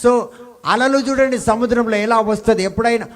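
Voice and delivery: high, loud, slow pulpit emphasis; fiery preacher pounding the scripture; preaching at full force. Acted. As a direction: hammer each word of the verse. Preacher drives the verse home.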